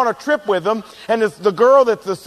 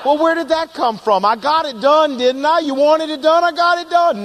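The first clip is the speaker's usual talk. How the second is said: higher, pushed-up indignant protest; indignant lion; 6 semitones higher than usual. Retold or imitated. Lion protesting to the beaver, mildly indignant. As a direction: defend yourself, offended. Mock quarrel, lion retorts.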